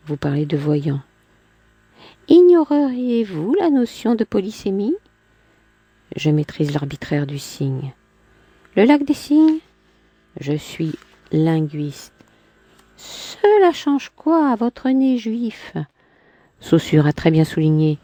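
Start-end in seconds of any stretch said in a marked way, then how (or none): 2.3-5.0 higher, lightly voiced woman; a hint of a proud woman; Clémentine's voice. Man voicing woman in dialogue. 8.8-9.6 higher, lightly voiced woman; a hint of a proud woman; Clémentine's voice. Man voicing woman in dialogue. 13.0-15.7 higher, lightly voiced woman; a hint of a proud woman; Clémentine's voice. Man voicing woman in dialogue.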